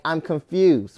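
ordinary talk, nothing marked

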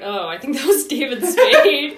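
high, loud, slow, laughing through it; laughing realization. Retold or imitated. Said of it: "I was like".

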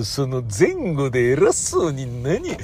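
ordinary talk, nothing marked

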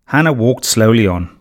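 usual speaking voice

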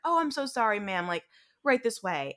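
fawning apology. Retold or imitated. Restaurant host fawning over American customer, gushing. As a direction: play an overeager waiter suddenly all smiles.

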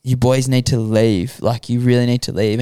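ordinary delivery

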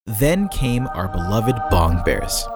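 ordinary talk

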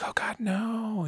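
high, soft and slow comforting; imitating a soft reassurance, high and slow; mock reassuring. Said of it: "And she's like".

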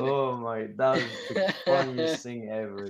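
words broken by real laughter; laughing. Real, not acted. Friend looking back on the funny classroom story, laughing. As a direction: talk through the tail of a laughing fit.

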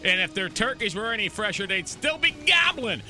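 upbeat announcer, pitch jumping up on key lines; radio sponsor read. Real, not acted. Host reading holiday sponsor spots and thanks, announcer style. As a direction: punch the product names like a local radio host.

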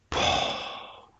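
breathy, voiceless disgust; disgusted groan. Real, not acted. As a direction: exhale the disgust. Disgust at loud eating noises.

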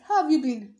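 loud cheery how; loud greeting. Shown as a demonstration. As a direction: call it out brightly. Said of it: "I keep asking".

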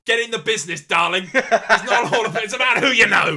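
high, very loud mock proclamation; hammed-up retort. Actor answering the little Scouser, loud and hammed-up. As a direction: belt it out as a comic retort. Retold boastful reply to young Scouser.

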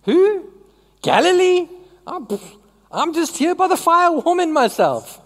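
high, squeaky feigned innocence; panicked denial. Retold or imitated. Peter nervously playing dumb by the fire, high-pitched. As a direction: act clueless, voice jumping up high. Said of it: "And he says".

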